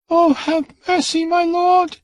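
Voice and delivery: very high, pleading scream; a terrified child begging; child begging her lord, very high scream; child's scream. A narrator's reading. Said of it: "screamed the child".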